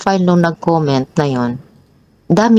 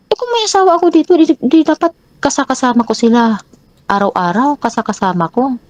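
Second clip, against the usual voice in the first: high, put-on voice of a defensive man; mimicking the partner's defensive reply; 7 semitones higher than usual. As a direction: play him brushing it off, high and pitched up. Quoting partner's fast denial.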